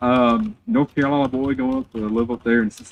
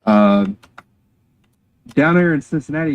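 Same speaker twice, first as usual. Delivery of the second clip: ordinary talk, nothing marked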